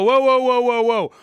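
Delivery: hammed-up halt; mock officials' whoa. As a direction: stop the crowd with mock alarm. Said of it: "And they go".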